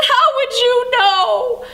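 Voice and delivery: put-on high, loud, drawn-out child's protest; mock wail back at the grown-up; crying child, imitated. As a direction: imitate an upset little girl, stretching it out. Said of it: "and she's crying".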